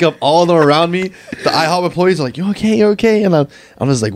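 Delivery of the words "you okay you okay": concerned employee. Retold or imitated. Employees checking on fallen friend.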